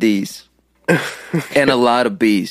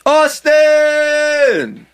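excited shout; 12 semitones higher, 4 dB louder than usual. Real, not acted. Reading a donation aloud.

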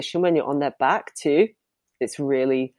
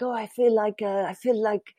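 put-on higher-pitched, lightly done French accent; hammy French accent impression; terrible French accent; 6 semitones higher than usual. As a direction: attempt a French guy's accent, badly. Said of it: "that's my terrible French accent".

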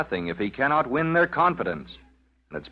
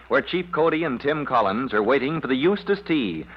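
normal talking voice

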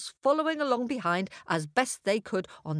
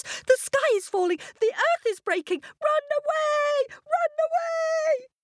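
much higher, frantic hare cry of alarm; panicked shout. A narrator's reading. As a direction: squeal it out like a terrified little hare. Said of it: "began to shout at once".

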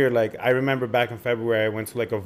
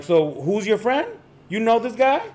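taunting challenge; 8 semitones higher than usual. Retold or imitated. Confronting the guys filming.

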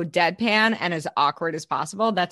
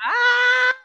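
loud high squeal; excited squeal; 5 dB louder than usual. Real, not acted. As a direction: squeal with delight. Birthday cheer.

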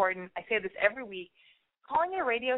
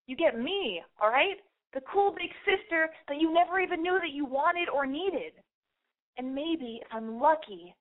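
ordinary delivery

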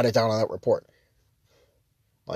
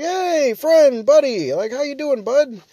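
much higher and louder, bubbly buddy greeting; cheery stranger greeting; 8 dB louder, slower than usual. Retold or imitated. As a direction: greet a stranger like an old pal. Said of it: "is just like".

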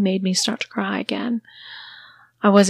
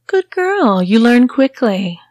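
put-on much higher, louder good girl; patronising mistress; 4 semitones higher, 6 dB louder, slower than usual. Mistress praises her obedient sissy, high and patronising. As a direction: praise like to a pet.

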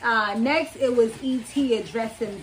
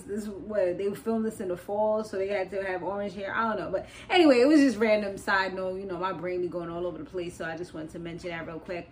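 ordinary talk, nothing marked

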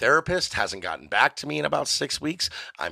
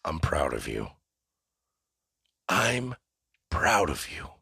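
very low, hushed fatherly voice; deep, slow mock-tender murmur; proud parent; 8 semitones lower, 4 dB quieter, slower than usual. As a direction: play a father softly telling his son. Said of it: "And then you're gonna say".

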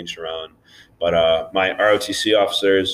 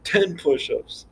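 a hint of a stern drill officer; high, slow mock drill sergeant; 9 semitones higher, 4 dB quieter, slower than usual. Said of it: "you'd get shit for that".

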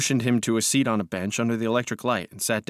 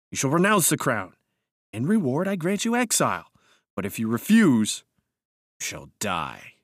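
imperious threat; 3 semitones higher than usual. A narrator's reading. Narrator offering exile or death, menacing. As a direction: pronounce sentence, dropping on the last words.

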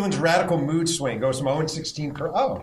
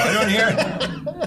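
mock plea; 9 semitones higher, 4 dB louder, much slower than usual.

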